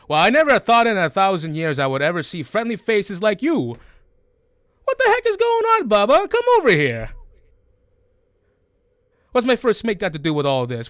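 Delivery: high, loud, brisk creature voice; jolly beholder captain. Acted. Beholder captain greeting friendly faces, high boisterous voice. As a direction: holler it high and hearty, rattling along.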